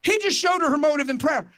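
ordinary delivery

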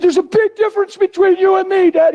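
put-on a distraught fourteen-year-old pleading with his dad, high; teenage son's voice. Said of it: "He said".

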